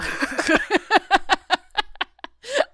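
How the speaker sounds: high, loud burst of laughter; triumphant laugh. Real, not acted. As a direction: laugh at own zinger. Laughs after own mic-drop.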